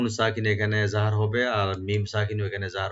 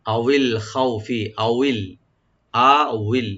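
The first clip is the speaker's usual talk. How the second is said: slow recitation; 5 dB louder, much slower than usual. Shown as a demonstration. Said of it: "এটা ওয়া আর উপরে নিচে জের আছে এখানে".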